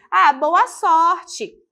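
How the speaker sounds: slow, drawn-out good-luck wish; warm good luck. Shown as a demonstration. Teacher wishing a student luck, drawn out and kind. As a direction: wish them luck kindly.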